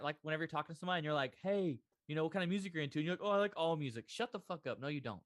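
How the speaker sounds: ordinary talk, nothing marked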